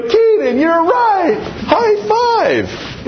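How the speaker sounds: very high, squeaky excited praise; dad praising his son, very high and excited. Retold or imitated. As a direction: cheer the kid on with a high five. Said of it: "And I go".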